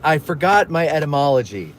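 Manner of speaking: ordinary talk, nothing marked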